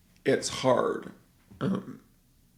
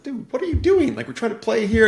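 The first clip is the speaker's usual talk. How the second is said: high, exasperated ballplayer voice; a touch of a surfer dude, much higher; annoyed ballplayer; 12 semitones higher, 7 dB louder than usual. Retold or imitated. Ballplayer shooing a man off the field, higher and exasperated. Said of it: "baseball player's like".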